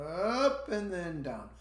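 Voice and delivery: "up" sliding high then "down" falling slowly; rise then fall. Shown as a demonstration. Teacher showing students question intonation, slow rise-fall. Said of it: "an intonation that goes up and then down".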